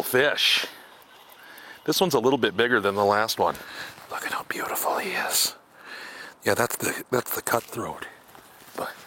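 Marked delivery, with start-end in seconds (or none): 4.1-8.9 hushed admiring whisper; soft whispered wonder. Real, not acted. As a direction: whisper in awe over the fish.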